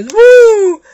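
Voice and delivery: high-pitched whoop; high "woo". Acted. Storyteller voicing characters taking flight, high whoop. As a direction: let out a flying "woo!". Cheer as they fly in story.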